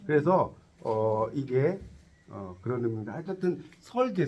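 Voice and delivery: normal talking voice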